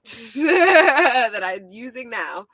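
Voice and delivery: laughing. Real, not acted. Laughs about taking nieces' clothes.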